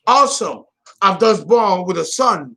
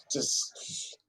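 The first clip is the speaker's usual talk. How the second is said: whisper; 7 semitones lower, 13 dB quieter than usual. Acted. Urging viewers to savor it.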